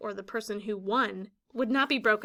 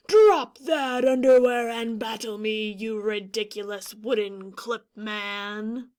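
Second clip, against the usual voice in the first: louder, bold warrior challenge; big bold Rock voice; 8 dB louder than usual. Shown as a demonstration. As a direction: puff up and boom like a rock. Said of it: "change my voice and make it sound more rock-ish".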